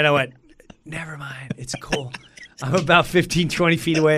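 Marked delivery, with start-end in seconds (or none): 0.8-2.1 whispered, sheepish retreat; hushed backing out; embarrassed whisper. Retold or imitated. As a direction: whisper it to call the whole thing off. Embarrassed backing away from snub.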